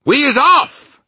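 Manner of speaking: high, very loud announcement; excited cry. A narrator's reading. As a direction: shout it in triumph. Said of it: "cried the B F G".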